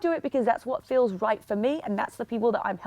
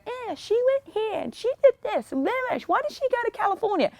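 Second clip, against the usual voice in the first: mocking gossip; 9 semitones higher than usual. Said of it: "we don't just sit there and go".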